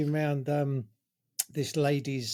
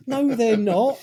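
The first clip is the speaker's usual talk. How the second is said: put-on high, louder childish protest; kid protesting; 8 semitones higher, 7 dB louder, slower than usual. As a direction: whine back like the kid who can't find them. Kid answers back.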